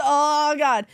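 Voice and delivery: real tears welling up; starting to cry. Real, not acted. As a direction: fan breaking down crying over the season. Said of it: "Oh, there she goes".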